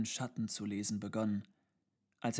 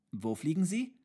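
put-on high, louder child's question; curious child; 7 semitones higher, 6 dB louder than usual. A narrator's reading. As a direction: ask like a small child, pitch rising. Child interrupts reading to ask. (neugierige, hohe Kinderfrage)

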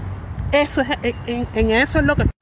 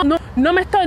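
ordinary talk

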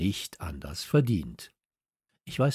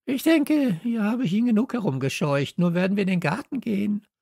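high, bright-pitched know-it-all dog voice, faster at the end; cocky dog Hasso; 11 semitones higher, 4 dB louder than usual. Acted. Police dog Hasso boasts to the other dog, high and smug.

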